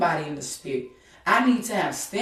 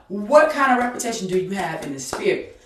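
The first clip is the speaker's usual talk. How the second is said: usual speaking voice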